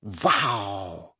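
low, breathed-out amazement; agog. A narrator's reading. Parvati hearing Hermione's gossip, agog. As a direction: let it out softly, amazed.

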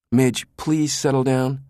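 pleading. A narrator's reading. Brinkerhoff, hands on her shoulders, pleads for calm. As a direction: stretch the please to calm her.

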